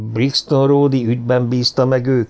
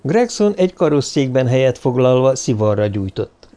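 usual speaking voice

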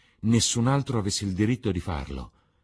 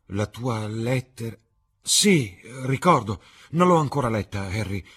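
Dorian, puzzled; 5 semitones higher, 6 dB louder than usual. Acted. Dorian recalls the letter.

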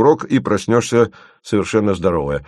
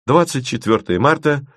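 plain reading voice

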